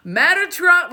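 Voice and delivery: high, loud mock awe; mocking imitation. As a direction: parody an overexcited conspiracy believer. Mocks conspiracy believers.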